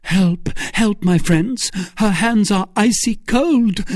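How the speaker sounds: high, loud call for help; panicked cry. A narrator's reading. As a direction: cry out in alarm to friends. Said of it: "cried D'Artagnan".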